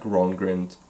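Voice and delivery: usual speaking voice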